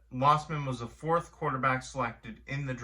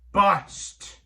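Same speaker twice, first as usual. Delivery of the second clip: emphatic shout; 6 semitones higher, 8 dB louder than usual. Real, not acted. Verdict on a bad pick.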